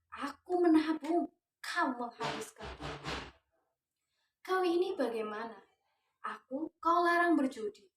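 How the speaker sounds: rising, forceful dramatic declamation; poetry recitation. Acted. Teacher reciting the poem to her students, dramatic declamation. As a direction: recite it like a stage protest poem.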